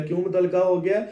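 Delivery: ordinary talk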